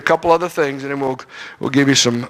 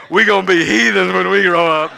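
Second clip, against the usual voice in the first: put-on kids in back seat; 2 semitones higher, 4 dB louder than usual. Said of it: "hitting each other saying".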